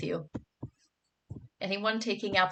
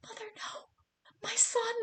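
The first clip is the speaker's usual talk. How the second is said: high, sobbing mock wail; a higher, hammed-up sob of a child begging mother; 14 semitones higher, 7 dB quieter than usual. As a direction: wail like a child losing a pet. Child wails over frog.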